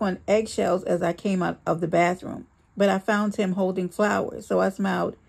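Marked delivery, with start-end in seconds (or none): none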